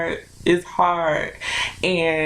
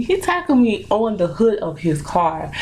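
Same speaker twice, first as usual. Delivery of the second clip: usual speaking voice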